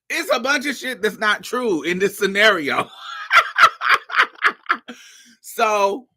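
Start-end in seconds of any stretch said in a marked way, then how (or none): 2.9-4.9 very high, loud laugh; a high squealing laugh; shrieking laugh. Real, not acted. Laughing at the scenario.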